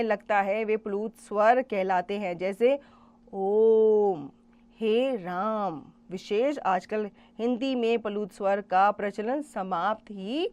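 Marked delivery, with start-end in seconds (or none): 3.3-5.8 ओम and हे राम with the vowels held very long and slow; drawn-out pluta vowels. Shown as a demonstration. Teacher stretches ओम and हे राम to show pluta vowels. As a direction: stretch each vowel to three beats. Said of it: "ह्रस्व स्वर से तिगुना समय".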